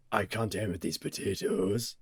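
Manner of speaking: a light Scottish Capaldi voice; Capaldi Scottish impression. Mocking the Sontarans' bad aim, in a light Scottish accent. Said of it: "you just turned into Capaldi".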